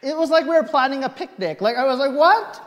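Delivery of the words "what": high, loud baffled what; incredulous what. Retold or imitated. Patient hearing doctor's zigzag plan, incredulous. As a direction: react in disbelief.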